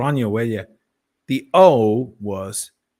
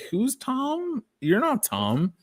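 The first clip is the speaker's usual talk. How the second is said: high, puzzled mock voice; mock confusion; 13 semitones higher, slower than usual. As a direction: squeak it out like baffled strangers. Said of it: "people would be like".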